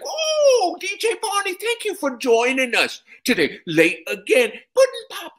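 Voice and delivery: put-on very high, loud, laughing old man voice; mocking Cosby impression. Comic as Cosby gloating at late actor, high and laughing. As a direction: cackle and tease like a gloating star.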